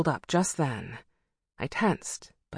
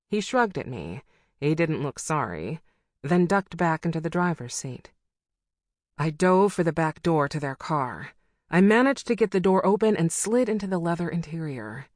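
ordinary delivery

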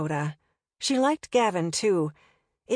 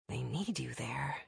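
whispering; 6 semitones lower, 12 dB quieter than usual. Acted. Pleading with Gavin.

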